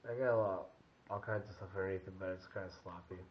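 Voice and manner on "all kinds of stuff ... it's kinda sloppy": ordinary talk, nothing marked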